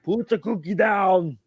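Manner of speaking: high, slow, loud Arnold bark. Retold or imitated. Host playfully scolds in an Arnold voice, loud and slow. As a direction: bark it like Schwarzenegger. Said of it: "Yes, I know".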